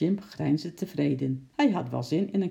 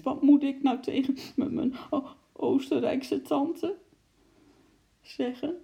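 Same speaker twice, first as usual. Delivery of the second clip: high, broken tearful wail; tearful sobbing; 10 semitones higher than usual. A narrator's reading. Henkie dreading what to tell his aunt, sobbing. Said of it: "snikte hij opeens".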